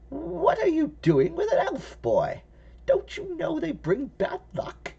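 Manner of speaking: put-on high, creaky old woman voice; little old lady voice. Old lady alarmed at elf.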